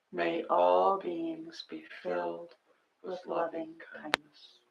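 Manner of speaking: slow, hushed loving-kindness chant; gentle meditation leader, almost whispering; guided meditation. Acted. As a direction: breathe each blessing out softly and slowly.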